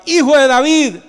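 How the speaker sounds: loud, high imitated call; shouted cry. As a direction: cry out to get his attention. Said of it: "porque ellos no gritaban".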